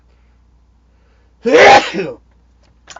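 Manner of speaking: loud, explosive real sneeze; big sneeze. Real, not acted. Host caught on a live show by a big sneeze. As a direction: let out a huge, high-pitched sneeze. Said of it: "Big sneeze".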